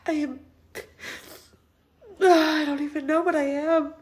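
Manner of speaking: real tears, voice breaking apart; crying and struggling to get words out, slow at the end; sobbing through the words. Real, not acted. Grieving alone, breaking down.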